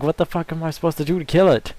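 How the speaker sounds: high, loud, fast exasperated yell; frantic gamer panic; panicked outburst. Retold or imitated. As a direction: blurt it out, overwhelmed by the boss. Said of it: "I'm like".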